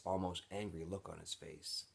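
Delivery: usual speaking voice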